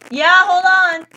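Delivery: loud call to parents off-camera, high and loud. Real, not acted. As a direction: shout back to the next room.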